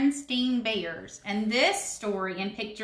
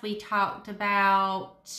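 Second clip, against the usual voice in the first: slow drawn-out recall; slower than usual. Real, not acted. Pastor to the kids, recalling the last lesson, drawn out slowly. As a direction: stretch the words out while searching memory.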